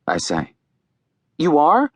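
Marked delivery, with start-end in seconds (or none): 1.4-1.9 higher, dismayed question; mildly distressed, rising in disbelief. A narrator's reading. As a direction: let the pitch climb with worry. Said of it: "Mel says, distressed".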